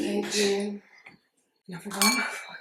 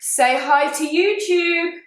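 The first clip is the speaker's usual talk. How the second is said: bright, high, drawn-out Say hi to YouTube; cheerful prompting; 7 semitones higher, much slower than usual. Real, not acted. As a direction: coax him cheerfully, slowly. Prompting dad on FaceTime.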